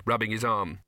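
plain reading voice

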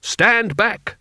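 high, bold command; shouted. A narrator's reading. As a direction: shout them back, drunk with power. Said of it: "shouted Rincewind".

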